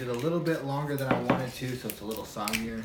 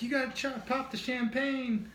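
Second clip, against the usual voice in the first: high, giddy, thrilled squeal; excited squeal; 13 semitones higher than usual. Retold or imitated. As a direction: gush with excitement. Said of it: "She got all excited".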